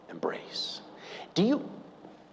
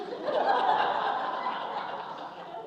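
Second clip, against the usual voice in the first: laughing; 5 dB quieter than usual. Real, not acted. Laughs at own story.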